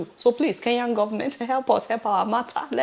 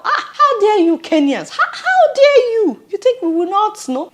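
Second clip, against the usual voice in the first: high, loud, laughing mock fury; playful indignant cry of how dare you; mock outrage; 11 semitones higher, 5 dB louder than usual. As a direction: scold the Kenyans in jest, half laughing. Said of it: "I'm really, really upset right now".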